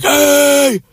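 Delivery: high, drawn-out call; mock yell, stretched out; retold yell. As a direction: holler a long high hey for attention. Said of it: "I just kinda yelled".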